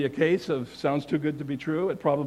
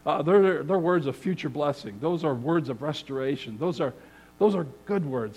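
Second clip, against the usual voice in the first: ordinary talk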